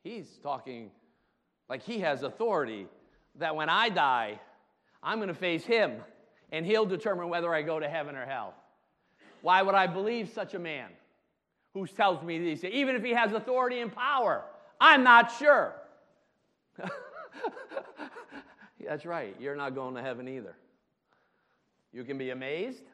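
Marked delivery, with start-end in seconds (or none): 0.0-15.8 doubting listener voiced louder and higher, rising near the end; skeptical crowd member. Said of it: "they're like". 16.8-18.8 sudden high burst of laughter. Real, not acted. Pastor laughs after acted quote.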